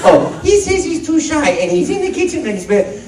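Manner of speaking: put-on high, quick relay to the child; Mario voice. Mario telling the child what Luigi said, high and quick. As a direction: report back cheerfully as Mario. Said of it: "And I go".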